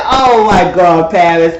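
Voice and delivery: loud, slow mock shock. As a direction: stretch it out aghast. Shocked reaction retold.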